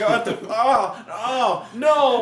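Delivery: loud, high, helpless villain cries; hammed-up shocked wailing through a laugh; mock dismay. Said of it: "he was not expecting this".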